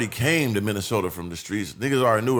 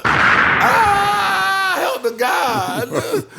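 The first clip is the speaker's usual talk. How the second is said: high, loud, drawn-out cry; a hammed-up scream as if hit; mock dying cry; 7 dB louder, much slower than usual. Acting out getting shot at the door, loud high wailing cry.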